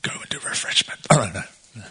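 whispered aside joke; joking whisper. Real, not acted. Preacher whispering a joke to the congregation about refreshments. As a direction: slip in a cheeky whispered joke. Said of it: "Sorry, shouldn't joke about that".

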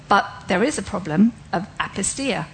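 normal talking voice

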